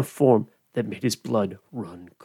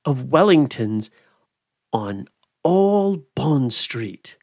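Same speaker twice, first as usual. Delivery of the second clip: plain reading voice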